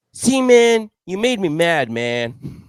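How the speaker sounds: very high, loud laughing put-on voice; mock teammate. Teammate retold blaming the teller for a bad shot, high and loud. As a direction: ham up the teammate's blame. Teammate blames him after shank.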